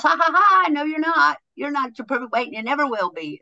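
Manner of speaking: a hint of a jeering voice in her head; mocking inner voice. Her inner voice scoffs at her affirmation, mock laughing. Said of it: "my little brain starts laughing".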